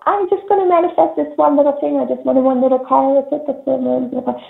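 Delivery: high, small voice playing someone who asks for very little; mock timid wisher. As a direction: squeak it out like a meek person who wants one tiny thing. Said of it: "You know".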